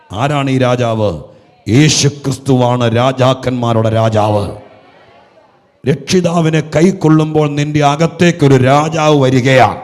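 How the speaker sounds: loud, slow proclamation; full-force preaching. Real, not acted. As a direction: proclaim the King to the hall. Preaching climax on Christ. (ശക്തമായി ഉറക്കെ പ്രഘോഷിക്കുന്ന പ്രസംഗം)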